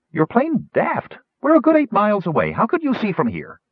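very high-pitched gnome voice; incredulous gnome. Acted. Gnome doubting colleague's sight of the ship, incredulous.

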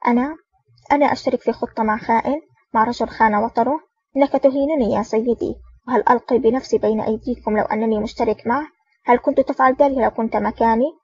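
usual speaking voice

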